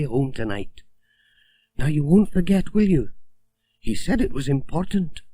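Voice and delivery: a chatty village shopkeeper; Missus Goggins. A narrator's reading. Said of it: "said Missus Goggins".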